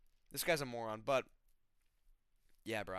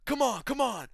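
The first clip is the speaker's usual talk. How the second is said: high, loud hyped-up yell; jumping and shouting come on before the fight; imitating hype shout; 9 semitones higher, 6 dB louder, slower than usual. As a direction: scream like a fighter pumping himself up. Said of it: "Ricky Turcios going, jumping and slamming".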